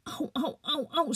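high pained cries. Acted. Injured Malte crying out from his bleeding leg, high pained yelps. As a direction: yelp high as if the leg hurts.

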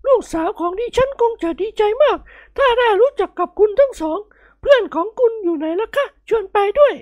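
very high, gushing falsetto lady; delighted matron. Acted. Lady winning the dinner guests, delighted falsetto. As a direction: coo and invite, beaming. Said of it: "คุณนายโอ่งยิ้มน้อยยิ้มใหญ่".

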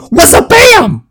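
high-pitched outburst out of nowhere; sudden attention-grabber. Shown as a demonstration. Presenter startling a drowsy audience mid-sentence, sudden high outburst. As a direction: jolt them with a sudden high exclamation. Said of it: "doing something like this".

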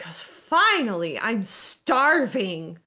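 slow, drawn-out relieved complaint; long, swooping, impatient exclamation; sister Pilar. Acted. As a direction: stretch the words with exaggerated hunger. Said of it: "Pilar just sort of like".

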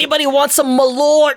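loud, high hammed-up Chicago accent; exaggerated Chicago accent. Joking about a Chicago accent, exaggerated Chicago call. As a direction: call it out in broad mock Chicago. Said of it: "What's the Chicago accent?".

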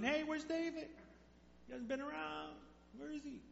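a king questioning his son sharply; King Saul asking. Retold or imitated.

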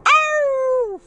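one long, high-pitched howl; dog howl imitation. Muffy the dog objecting to the Great Dane, howling. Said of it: "Muffy's like".

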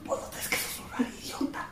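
voiceless whispered scolding; imitating a whisper. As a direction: whisper the insult back at her. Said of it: "Y sigue susurrando".

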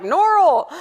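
high, loud "Norrell", OR in place of the vowel; or language. Retold or imitated. Worship director playing along on the phone, high, loud "Norrell". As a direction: call "Norrell" with a playful swollen OR. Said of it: "plays along, and he's like".

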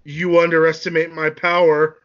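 loud, swelling villain boast; defiant boast. Acted. Anakin defies Obi-Wan's warning, loud boast. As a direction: boast it loudly at your rival.

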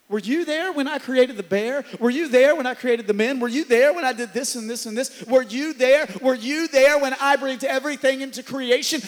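building pulpit crescendo as God's voice; full-force preaching. Real, not acted. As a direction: drive each question home to the crowd.

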